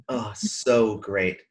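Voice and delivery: normal talking voice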